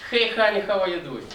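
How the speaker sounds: loud, high-pitched Russian-accented greeting; heavy Russian accent. Shown as a demonstration. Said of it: "with Russian accent, it will be something like".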